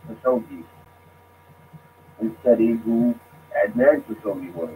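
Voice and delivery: usual speaking voice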